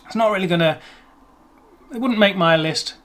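ordinary delivery

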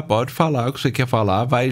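ordinary talk, nothing marked

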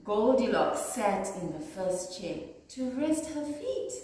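ordinary delivery

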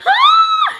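high frightened shriek; scream. Retold or imitated. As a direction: shriek in panic on a stalled ride. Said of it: "and I am like".

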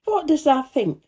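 high Yorkshire question through a grin; cheerful grin. A narrator's reading. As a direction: tease the surprise with cheer. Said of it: "with a cheerful grin".

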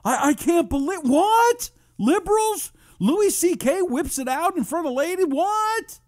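hammed-up disbelief in a loud falsetto; feigned shock. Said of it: "get past the pretense".

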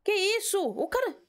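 sudden high-pitched what's that; startled high gasp. Real, not acted. Streamer spotting a figure in the dark, startled. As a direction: catch your breath, spooked.

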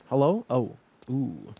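ordinary delivery

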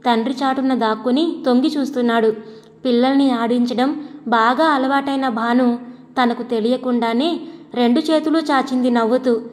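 plain reading voice

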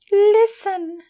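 put-on high tense hushed girl's voice; mock whisper. Germaine hushes the frightened girls, high and tense. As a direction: hush the room in fear.

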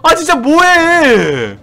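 slow, high, loud exasperated shout. Real, not acted. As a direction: drag it out in disbelief. Exasperated at other players.

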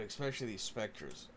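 usual speaking voice

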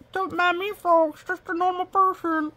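cute little creature. Pretend. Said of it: "He's like".

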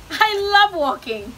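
high, loud burst of enthusiasm; delighted. A narrator's reading. Beaver joining Bird's walk, delighted. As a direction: gush it out with gusto.

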